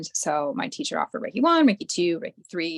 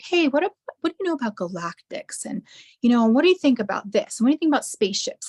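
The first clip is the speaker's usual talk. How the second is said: high, eager, rushing curious questions; eager friend; 5 semitones higher, 3 dB louder, faster than usual. Retold or imitated. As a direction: pitch it up like a keen friend probing. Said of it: "she's like".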